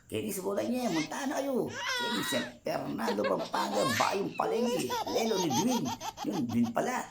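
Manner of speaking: high, giggly, words breaking into laughter; real giggling fit mid-sentence; laughing. Real, not acted. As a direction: laugh your way through the place names. Giggling while naming the place.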